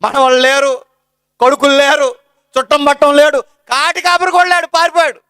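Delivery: sermon at full force; fervent preaching. Acted. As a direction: preach it out to the congregation.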